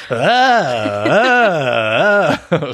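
hammed-up pained groans, one after another; imitating moans. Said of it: "he's going".

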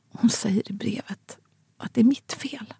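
barely voiced whisper, breaking off; whispered confession. Daughter whispers to her father that mother blamed her. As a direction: whisper it, hardly able to say it.

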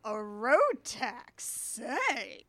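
lightly voiced smug nobleman; slow nobleman's sly echo. Co-host echoes the nobleman's line with mock intrigue. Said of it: "the nobleman is like".

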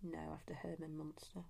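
hushed whispered reply. Real, not acted. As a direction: drop to a near whisper.

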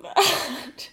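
usual speaking voice

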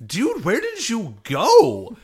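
loud, high, drawn-out disbelief; mock bewilderment. Other Rangers catch up with runaway Red, mock bewildered. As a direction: stretch it out like a baffled teammate.

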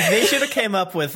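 normal talking voice

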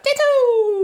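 very high squeaky creature cry; Ditto. Acted. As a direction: chirp it out like a little Pokémon. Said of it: "when it turns back into the Ditto".

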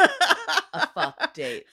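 slow, loud high words through laughter; laughing. Real, not acted. Joking about lawyers canceling meetings, laughing.

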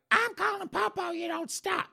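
put-on very high shrill old mother; high falsetto mama pleading. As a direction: squeal like a panicked mom. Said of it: "Mama was like".

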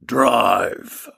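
low husky command; a rough, husky order. Acted. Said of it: "A husky voice from the back".

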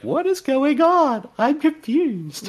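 high slow mock-confused voice; mocking Marcy. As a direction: imitate a baffled friend, high and slow. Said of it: "Marcy's input".

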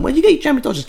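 high put-on customer voice; customer asking. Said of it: "walked into a newsagent and went".

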